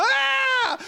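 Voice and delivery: put-on high sudden yelp of a hurt kid; child's cry. Pastor imitating a kid's cry after a crash, sharp high yelp. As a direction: yelp like a child who just fell.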